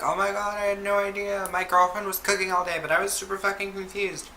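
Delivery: high-pitched imitation of a surprised man; shocked uncle. Uncle to family at his surprise birthday, mock shocked. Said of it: "he is shocked that we all came".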